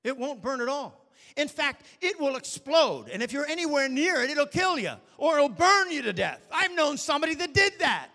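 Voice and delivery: a building, forceful preacher's cry; rising to a full-voiced warning; preaching loud. Real, not acted. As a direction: hammer the danger home.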